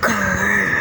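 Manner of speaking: soft growling wolf. Acted.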